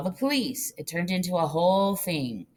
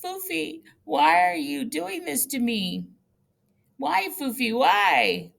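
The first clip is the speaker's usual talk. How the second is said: begging; 9 semitones higher, 3 dB louder, slower than usual. Retold or imitated. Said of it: "begged her".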